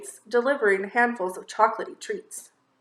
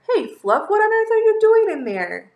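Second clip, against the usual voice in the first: high, loud astonished call; a bunny asking his friend stuck in a log; surprised call; 10 semitones higher, 7 dB louder than usual. Acted. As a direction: sound amazed at what you see. Tiny finds friend stuck.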